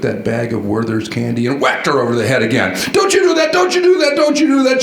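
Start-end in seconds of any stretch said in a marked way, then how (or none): 2.9-4.8 imitating a mom's angry scold, much higher and louder; a mock furious high warning. Mom scolds her son for hitting her again, mock angry, much higher and louder. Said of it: "she said in an angry tone".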